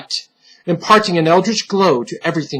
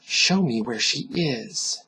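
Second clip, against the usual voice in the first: soft, slow, menacing demand; 4 semitones lower, 10 dB quieter, slower than usual. Acted. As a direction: press him gently, menacingly. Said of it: "The soft tones demanded".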